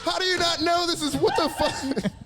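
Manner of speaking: high, laughing disbelief; mock baffled audience. Comic mimicking a gullible crowd member, high and laughing. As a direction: play a stunned crowd member, high and giggling.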